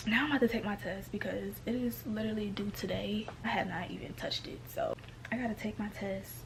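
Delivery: late-night hushed voice; whispering. Real, not acted. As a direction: whisper so nobody wakes.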